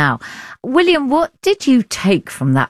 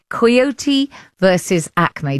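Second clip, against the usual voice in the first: ordinary delivery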